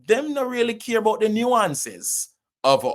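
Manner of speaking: high-pitched Jamaican Patois complaint. Real, not acted. Actor on decision makers ignoring Caribbean nuance, Jamaican Patois. Switches into Patois, then restates in standard.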